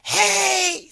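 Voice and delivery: high loud call; high loud hey. Acted. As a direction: call after her. Frog calls after princess.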